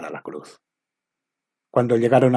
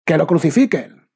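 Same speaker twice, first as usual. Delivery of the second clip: even louder and higher mob yell; louder shout; 6 semitones higher, 6 dB louder than usual. A narrator's reading. The crowd drowning out Pilate's plea, full shout. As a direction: outshout the first cry. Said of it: "gritaban más fuerte".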